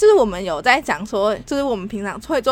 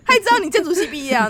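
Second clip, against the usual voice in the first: ordinary delivery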